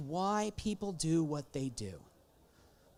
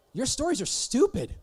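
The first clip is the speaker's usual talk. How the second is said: ordinary talk, nothing marked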